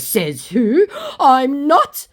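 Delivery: high, loud scammer protest; hammed-up outraged comeback; mock indignant denial. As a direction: protest like an offended child.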